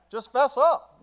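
preacher shouting at the sinner; shouted plea. Shown as a demonstration. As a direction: shout it to the back pew. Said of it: "repeated louder".

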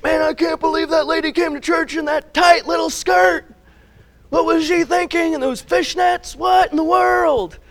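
high, loud scandalised gossip; mock gossip. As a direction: sneer at her like a shocked churchgoer. Mocking Facebook gossip.

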